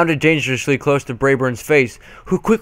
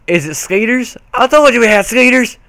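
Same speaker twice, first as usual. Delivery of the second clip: confused granny; 9 semitones higher, 4 dB louder than usual. A narrator's reading. Granny voiced in the story.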